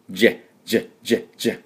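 ジェ said softly as the start of Japan; light repeated ジェ, as in Japan; je sound. Shown as a demonstration. Teacher drilling the first sound, softly repeated. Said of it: "ジャパンと一緒です".

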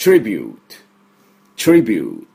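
トリビュート in English, falling to a light final t; English pronunciation. Shown as a demonstration. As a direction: say トリビュート with fused "tr" and long "yoo". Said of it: "私の後について発音しましょう".

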